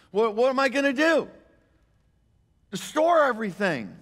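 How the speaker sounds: high, loud frantic rich man voicing the parable; rich fool. Pastor voicing the rich fool, high and frantic. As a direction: panic over where to store it all.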